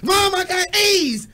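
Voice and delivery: put-on slow, high shout of a proud kid; a kid shouting to Mom about his grades, high and drawn out; kid bragging. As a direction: drag it out, yelling like a kid waving his report card. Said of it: "you're like".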